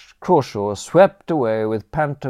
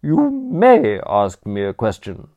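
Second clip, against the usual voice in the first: haughty aunt; 3 semitones higher, 6 dB louder than usual. Acted. Aunt to nephew Bertram, despairing grande dame scorn. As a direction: look down your nose at the nation's ruin. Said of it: "she corrected".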